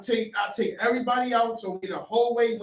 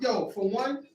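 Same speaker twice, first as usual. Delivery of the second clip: usual speaking voice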